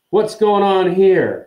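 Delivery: cold, slow drawl, pitched high and loud; haughty stretched-out question; Draco Malfoy. A narrator's reading. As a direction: drawl it out coldly. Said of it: "drawling voice of Draco Malfoy".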